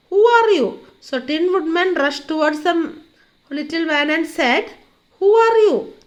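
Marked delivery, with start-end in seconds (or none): 0.1-0.7 higher, louder challenge; crying out. A narrator's reading. Rushing at the little man, crying out. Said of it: "cried out". 5.2-5.9 higher, louder challenge; crying out. A narrator's reading. Rushing at the little man, crying out. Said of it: "cried out".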